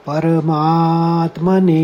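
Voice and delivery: परमात्मने said slowly, weight on मा; परमात्मने with full force on मा; stress on माँ. Shown as a demonstration. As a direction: lean on मा in परमात्मने, slow. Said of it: "उसको स्ट्रेस करके बोलना है".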